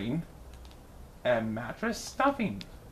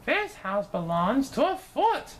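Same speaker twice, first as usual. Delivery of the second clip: high, huffy bird wife; Missus Bird; 10 semitones higher than usual. A narrator's reading. Missus Bird turns down the house, high and huffy. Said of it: "said Missus Bird".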